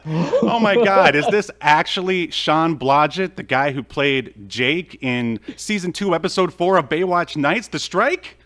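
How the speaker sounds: loud, high, hammed-up disbelief; mock amazement. Host meets the long-lost actor, loudly mock-amazed. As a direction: announce the guest in feigned astonishment.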